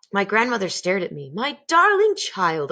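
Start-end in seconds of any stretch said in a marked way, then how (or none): 1.3-2.7 higher, slow fond voice. A narrator's reading. Grandmother praising her grandson, slow and fond. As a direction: marvel at the clever child.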